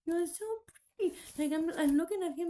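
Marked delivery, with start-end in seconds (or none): 0.0-1.2 gushing. Retold or imitated. Telling Jesus in the drawing, a high gushing compliment. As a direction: gush over him adoringly.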